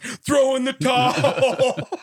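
drawn-out high, loud corner yell; hammed-up movie cornerman bellow; Sylvester Stallone. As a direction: holler it long and high, like pleading from ringside. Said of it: "Sylvester Stallone's in the corner like".

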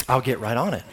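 low, soft, meek giving-in after a look; sheepish husband. Retold or imitated. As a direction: sheepishly give in to the strong wife. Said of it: "And then she gives you a look".

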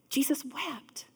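hushed, breathy scripture line; reverent whisper. Acted. Preacher hushes the room for the shortest verse, whispering. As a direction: breathe it like a sacred secret.

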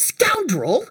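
high-pitched gnome outcry; indignant shout. Acted. Gnome answering the kobold's accusation, high acted shout. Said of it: "the gnome shouts".

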